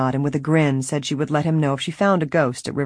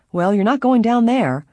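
higher, louder firm warning; 7 semitones higher, 4 dB louder than usual. A narrator's reading. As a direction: put your foot down. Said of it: "Helen said firmly".

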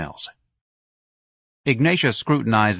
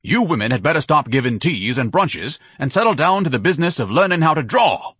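louder, higher booming rebuke; thundering; 6 semitones higher, 4 dB louder than usual. A narrator's reading. Ignatius thunders at the ladies, booming. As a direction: thunder the lecture at them. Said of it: "Ignatius thundered".

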